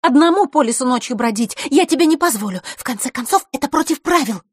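high-voiced, adamant girl; unyielding. A narrator's reading. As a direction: lay down the rules, high and insistent. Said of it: "непреклонно заявила Рита". (непреклонно, высоким голосом)

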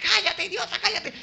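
high-pitched scolding cry; angry hushing. Acted. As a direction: hush him in a strained high voice. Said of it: "Y lo mandaban a callar".

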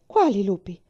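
loud, frightened demand; alarmed cry. Acted. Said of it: "gridò lei".

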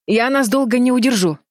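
high, urgent begging; desperate plea. A narrator's reading. Колтон hangs by one hand and begs, desperate plea. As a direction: beg them to hurry. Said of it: "взмолился Колтон".

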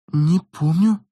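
ordinary delivery, nothing marked

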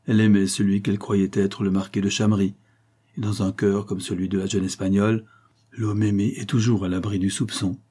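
ordinary delivery, nothing marked

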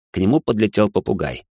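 ordinary delivery

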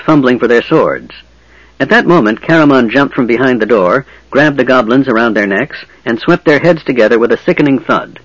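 ordinary delivery, nothing marked

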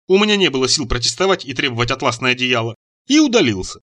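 ordinary delivery, nothing marked

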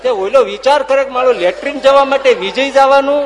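loud, high, laughing delivery; laughing punchline. Real, not acted. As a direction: burst out with the joke's payoff. Punchline of the latrine joke. (હસતાં હસતાં જોરથી વાર્તાનો અંત)

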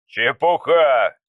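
exclaiming. Acted. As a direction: dismiss it out loud. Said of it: "воскликнул Устриц". (воскликнуть «Чепуха!» медленно и громко)

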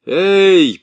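loud, high shout to soldiers; calling out. A narrator's reading. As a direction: call the soldiers over.

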